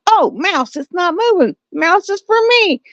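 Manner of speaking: high-pitched voice for an eager cat; cat's thoughts. Acted. Cat spots a mouse on the glue trap, high eager cat voice. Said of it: "Cat sees".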